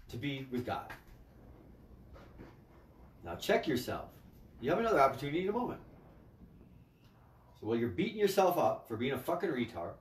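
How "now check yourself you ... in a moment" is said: usual speaking voice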